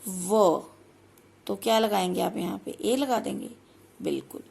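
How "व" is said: 'व' said alone as a clear w sound; 'व' sound demo. Shown as a demonstration. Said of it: "ओ की pronunciation क्या है". ('व' की ध्वनि अलग से बोलकर)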